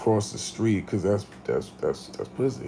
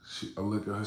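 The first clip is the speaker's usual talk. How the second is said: ordinary delivery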